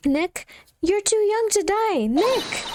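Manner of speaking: high, drawn-out melodramatic cry; panicked wail. Acted. Sidekick crying out to Nick under attack, high panic. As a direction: wail for a friend in acted despair.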